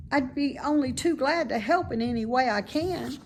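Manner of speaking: put-on bright childlike pig, fond of the barn; high, eager young pig; young pig Wilbur. Wilbur voiced higher.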